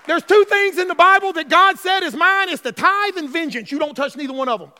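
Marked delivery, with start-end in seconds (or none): none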